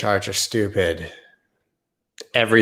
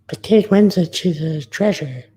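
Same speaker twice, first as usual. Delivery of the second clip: much higher, dreamy swoon, slowing; swooning fan; 9 semitones higher, 4 dB louder than usual. Acted. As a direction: gush over her like a smitten fan. Swooning over the actress.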